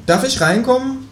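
very high, asking kangaroo voice; high-pitched kangaroo visitor. Acted. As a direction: ask it up in a squeaky register.

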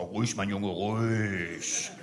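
calming hush. Retold or imitated. Calming a stuttering officer.

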